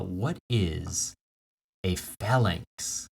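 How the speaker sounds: ordinary talk, nothing marked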